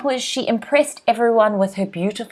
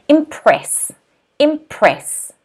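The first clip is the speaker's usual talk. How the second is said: slow impress, press stressed; 4 semitones higher, much slower than usual. Shown as a demonstration. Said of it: "that syllable after is stressed".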